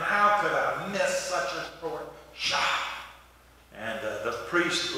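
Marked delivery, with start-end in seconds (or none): 0.0-2.6 exasperated moan, kept soft; mock cussing. Golfer stomping after a missed putt, mock exasperation. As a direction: play a frustrated golfer, lightly. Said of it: "he was cussing".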